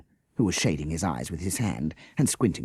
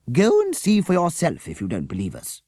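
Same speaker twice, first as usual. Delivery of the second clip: higher, louder cross challenge; 11 semitones higher, 5 dB louder than usual. A narrator's reading. Teacher to the policeman, crossly. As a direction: snap the dare at him. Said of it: "said Miss Beaver crossly".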